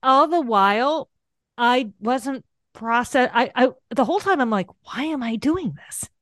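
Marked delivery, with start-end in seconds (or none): 4.8-6.1 softer, higher voice questioning yourself; baffled self-questioning. Retold or imitated. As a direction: act out bewildered self-talk, softly. Acts out questioning herself, first take.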